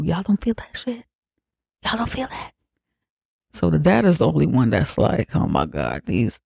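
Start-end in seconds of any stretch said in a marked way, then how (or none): 0.0-2.5 higher, softer, rising panicked questions; mock-spooked son, voice climbing and going thin; freaking out. Son asking his family if they feel the air, higher and scared. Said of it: "freaking out". 5.3-6.4 much lower, softer, worn-out groan; a lightly mimicked fed-up dad sinking low; exasperated groan. Dad fed up with his family, low exasperated groan.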